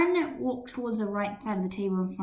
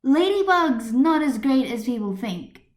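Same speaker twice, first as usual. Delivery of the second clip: heated argument; 5 semitones higher, 6 dB louder than usual. Acted. Debater raises voice.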